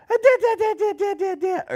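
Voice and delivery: high, loud babbled da-da-da; happy post-sneeze noise. Shown as a demonstration. Parent showing the noise made after sneezes, high happy babble. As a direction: squeal a quick string of happy nonsense syllables. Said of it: "happy noise afterward".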